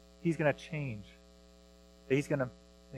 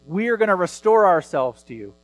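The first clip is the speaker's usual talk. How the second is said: higher, louder eager promise to God; mock self-reliant vow; 3 semitones higher, 5 dB louder than usual. Pastor mimicking people who vow to fix themselves, eager and raised. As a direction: sound like a keen churchgoer promising to fix it all. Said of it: "He's not saying".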